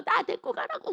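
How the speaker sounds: very high mock wail; tearful child. Small child begs departing mother, crying. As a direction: imitate a child wailing to come along. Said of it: "막 울면서".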